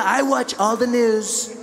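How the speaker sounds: slow drawled Trump voice; lightly voiced Trump, slow and smug; Trump impression. Trump boasting to Melania, slow smug impression.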